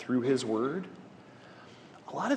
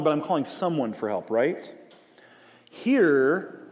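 ordinary delivery

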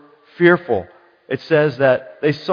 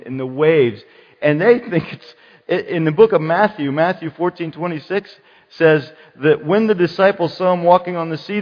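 normal talking voice

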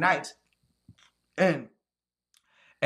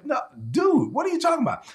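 exasperated outburst; 8 semitones higher than usual. Real, not acted. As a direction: blurt it out in disbelief. Outburst at a caller.

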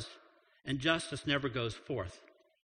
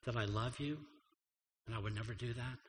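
tender reassurance; 5 semitones lower, 9 dB quieter than usual. Retold or imitated. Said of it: "basically God says".

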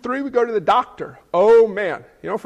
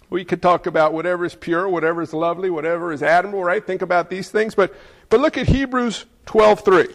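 ordinary talk, nothing marked

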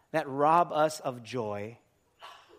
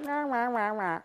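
high mocking "wah wah" babble; wife tuning out; 7 semitones higher than usual. Wife hearing her husband's lecture, mocking wah-wah noise. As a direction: imitate droning lecture noise, pitched up. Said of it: "the wife is like".

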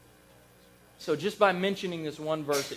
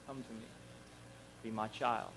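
much softer, gentle call; tender invitation; 6 semitones lower, 14 dB quieter than usual. Retold or imitated. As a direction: coax them in quietly and kindly. God's invitation voiced in sermon.

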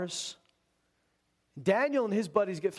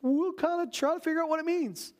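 pleading wise men; 10 semitones higher than usual. Retold or imitated. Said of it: "They're saying".

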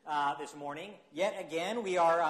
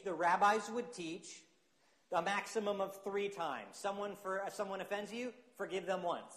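ordinary delivery

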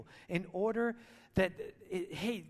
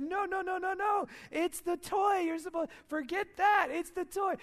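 urgent, high-pitched rapid protest, acted out; frantic dad correcting; 10 semitones higher, 4 dB louder than usual. Dad redirecting his toddler to the Christmas toy, frantic. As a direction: re-enact a flustered dad insisting, voice pitched way up.

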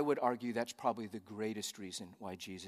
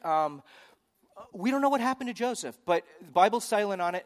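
ordinary talk

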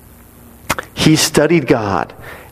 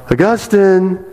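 higher, drawn-out name call; mock calling out; 8 semitones higher than usual. Preacher acting a former mistress calling out to Augustine, mock call. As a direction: lightly imitate a woman calling out. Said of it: "She called out".

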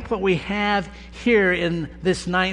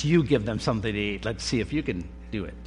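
lower, fast, lightly taunting dare; mock taunt; 6 semitones lower, 4 dB quieter, much faster than usual. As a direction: toss it off as a mild tease. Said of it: "kind of taunting them".

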